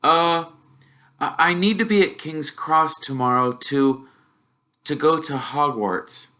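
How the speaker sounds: put-on nervous boy asking his uncle; young Harry, unsure and polite; timid boy.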